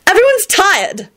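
slow, high fretful whine; high, dragged-out complaint; wailing. A narrator's reading. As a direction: draw it out in a high wail. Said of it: "she wailed".

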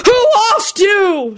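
slow, very high indignant shriek; angry screech. A narrator's reading. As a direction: screech back in outrage. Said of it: "screeched back angrily".

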